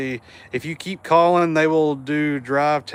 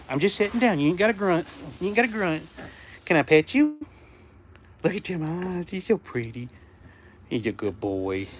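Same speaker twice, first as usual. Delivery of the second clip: higher, soft, gentle coaxing; soothing the dog; 3 semitones higher, 5 dB quieter than usual. Real, not acted. Owner sitting by her growling dog, soothing him softly. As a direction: talk him down softly, he's grumpy.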